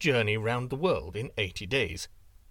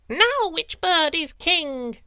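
much higher, louder, slow triumphant wren; wren crowing; 14 semitones higher, 6 dB louder, slower than usual. A narrator's reading. Wren taunting the eagle from above, high and triumphant. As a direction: crow it from the sky. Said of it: "cried the wren".

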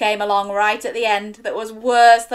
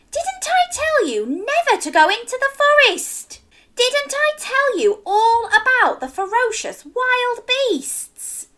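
scolding mom; 4 dB louder than usual. Acted. Mother scolding child.

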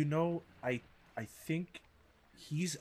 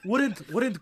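laughing; 10 semitones higher, 9 dB louder than usual. Real, not acted. Laughing through the words.

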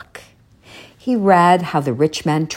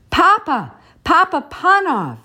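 high, raised call from nowhere; 3 dB louder than usual. A narrator's reading. The voice wakes the sleeping shoemaker, high call. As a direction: call his name from far away. Mysterious voice calls out.

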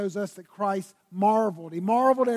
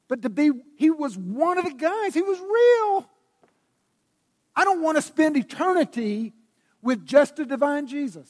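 high, loud, emphatic declaration; impassioned preaching; 7 semitones higher, 7 dB louder than usual. Real, not acted. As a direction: raise the voice and proclaim it with conviction. Preacher's climax on a real Jesus.

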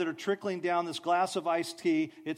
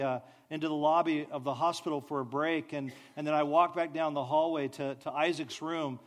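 ordinary talk

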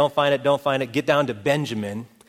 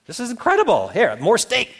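higher, playful mock amazement; amazed delight; 7 semitones higher than usual. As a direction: marvel like a delighted dinner guest. Bewildered brothers marvelling at the feast.

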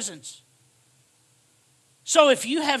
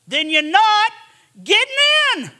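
much higher and louder on not getting in, ringing out; loud repeat; 7 semitones higher, 6 dB louder than usual. Shown as a demonstration. Preacher warning congregation, repeat shouted high and loud. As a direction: throw it at them the second time.

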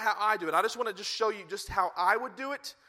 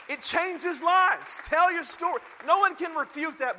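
high, loud preaching peak; fervent preacher at the climax; 8 semitones higher, 6 dB louder, slower than usual. Real, not acted. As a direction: lift it to a rallying cry. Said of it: "preaching climax".

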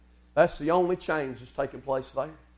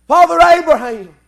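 loud acted scream for help; anguished cry; 11 semitones higher, 15 dB louder than usual. Rich man in hell begging Abraham, anguished cry. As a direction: cry out loudly as if in torment. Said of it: "He was screaming, crying, and weeping".

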